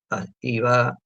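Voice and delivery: normal talking voice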